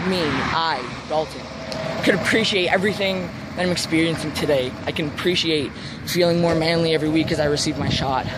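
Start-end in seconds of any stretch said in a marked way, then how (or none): none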